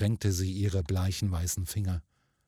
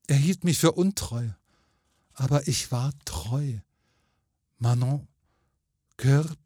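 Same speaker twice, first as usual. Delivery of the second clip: higher, earnest ghost lady; plaintive, higher-pitched insistence; protesting ghost woman; 5 semitones higher than usual. A narrator's reading. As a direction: protest your faithfulness as a wronged spirit. Ghost woman protests she was faithful.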